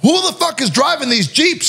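high, ranting mock yell; hammed-up fuming director; angry director. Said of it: "He's like".

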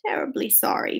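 soft, breath-light apology; hushed little mouse; murmured. A narrator's reading. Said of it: "murmured the mouse".

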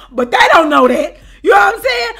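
loud words through laughter; laughing. Real, not acted. Storyteller tickled that they don't know it, laughing loudly. Amused that they believed her bluff.

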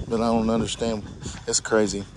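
ordinary talk, nothing marked

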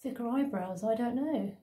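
face covered while talking; through a sheet mask. Real, not acted. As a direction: mask limiting cheek movement. Seen 0: Wet sheet mask on face throughout, mouth opening left uncovered.

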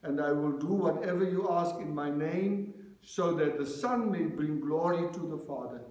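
usual speaking voice

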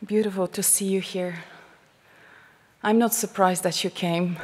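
usual speaking voice

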